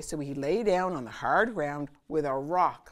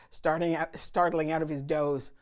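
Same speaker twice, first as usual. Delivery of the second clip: usual speaking voice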